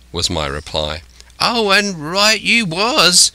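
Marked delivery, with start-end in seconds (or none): 1.4-3.3 high, drawn-out castaway cry; crying out in agreement. A narrator's reading. Castaway agreeing with Jim, crying out. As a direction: cry it out slowly, pitched well up. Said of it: "he cried".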